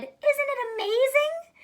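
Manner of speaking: put-on high, slow old lady voice. A narrator's reading.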